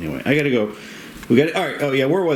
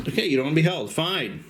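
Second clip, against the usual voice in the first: ordinary delivery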